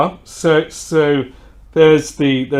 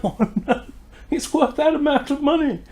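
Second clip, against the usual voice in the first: much higher, shocked; shocked realisation. Retold or imitated. The customer reacts to the wine price, shocked and high. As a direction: squeak it out in disbelief.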